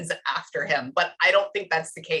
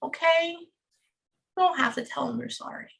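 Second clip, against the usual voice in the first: tender, emotional reassurance; 6 semitones higher than usual. Real, not acted. Medium relieving a grieving client of guilt, tender reassurance. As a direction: reassure gently in a raised, tender pitch.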